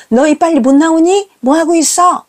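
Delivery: high-pitched cross call; mother losing patience, calling the kids; rising impatient voice. Retold or imitated. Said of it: "제 목소리가 또 올라갑니다".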